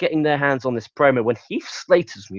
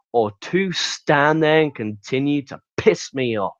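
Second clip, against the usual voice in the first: slow, deliberate retold threat; menacing ultimatum; slower than usual. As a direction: spell out each choice slowly, as a warning. Acting out a wrestler's threatening ultimatum.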